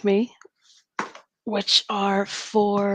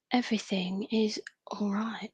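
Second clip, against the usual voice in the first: put-on English accent, softer; 7 dB quieter than usual. Streamer chatting to viewers, slipping into an English accent. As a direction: say it in a casual mock English accent. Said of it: "doing an Eng-, English accent".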